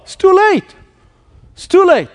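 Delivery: high, despairing inner voice; despairing thought. Retold or imitated. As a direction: cry it out high like all hope is gone. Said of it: "How many times have you thought".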